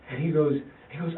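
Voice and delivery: ordinary talk, nothing marked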